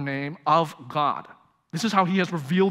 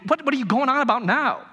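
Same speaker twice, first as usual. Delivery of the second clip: frustrated student; 7 semitones higher than usual. Acted. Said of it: "a little bit frustrated".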